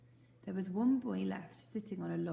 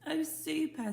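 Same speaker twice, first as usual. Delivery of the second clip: delighted; 9 semitones higher, 5 dB louder, slower than usual. Acted. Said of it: "said Plop".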